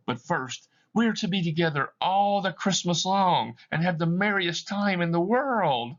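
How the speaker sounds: put-on child calling her brother home; little girl Fan. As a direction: play a six-year-old sister. Little Fan excitedly explains.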